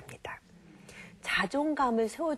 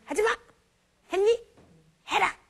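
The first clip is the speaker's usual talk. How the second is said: much higher, louder bossy orders; mock nagging commands; 11 semitones higher, 5 dB louder than usual. Parent ordering child about, sharp nagging. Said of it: "이 말만 해줘".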